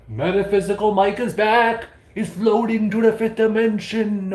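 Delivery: a touch of mystic hype; light mystical announcer; mock announcer. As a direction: repeat it, playing along. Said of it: "Watch this".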